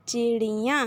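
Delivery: ordinary delivery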